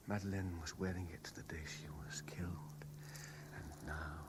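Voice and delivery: a soft, low whisper; hushed whisper. Acted. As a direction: reveal it as if it were a secret. Hushed revelation about the necklace.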